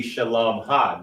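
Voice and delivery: "shalom ha" with a distinct h on the ending; separate possessive suffix. Shown as a demonstration. Teacher explaining her peace, "shalom ha" with the suffix distinct. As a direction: say "shalom", then add an audible "ha". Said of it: "to say her peace".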